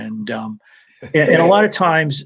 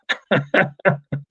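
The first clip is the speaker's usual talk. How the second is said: laugh; 4 semitones higher than usual. Real, not acted. Laughing at singed eyebrows.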